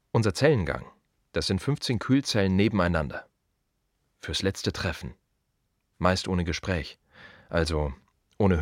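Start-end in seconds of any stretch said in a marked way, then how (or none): none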